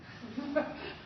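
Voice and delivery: high, soft laugh. Real, not acted. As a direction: laugh in amazement. Laugh at outcome.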